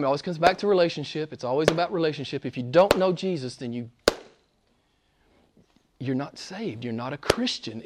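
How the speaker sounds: quiet urgent appeal; hushed earnest. Real, not acted. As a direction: lean in and say it softly. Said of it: "preacher drops to intimate tone".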